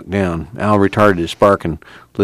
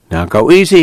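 raised, higher call to a driver; 7 semitones higher, 6 dB louder than usual. A narrator's reading. Tom calls to Al in the car, raised warning call. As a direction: call it across to him. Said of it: "he called".

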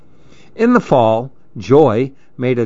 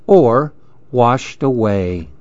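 plain reading voice

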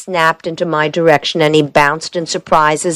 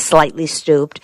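ordinary delivery, nothing marked

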